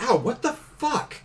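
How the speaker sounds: high, pained enemy voice; indignant monster yelping; unhinged villain. Acted. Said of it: "and he's like".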